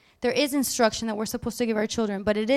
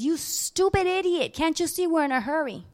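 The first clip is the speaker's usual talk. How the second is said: harsh scolding mother; 5 semitones higher than usual. Retold or imitated. Mother in an airport berating her small son, harsh scold. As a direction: belittle the child. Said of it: "the lady turns to him and says".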